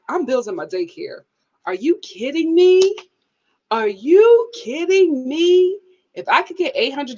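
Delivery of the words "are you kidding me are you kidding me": high, drawn-out disbelief; thrilled, rising disbelief; incredulous excitement. Real, not acted. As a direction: ask it high, slower the second time. Elated at daycare money.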